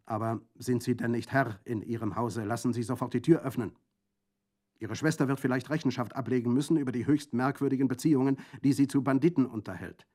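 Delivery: laut und schnell fordernd, empört; aufgebrachter Präfekt. Acted. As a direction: ein Beamter, der die Geduld verliert. Präfekt fordert zornig Einlass. (empört und laut fordernd)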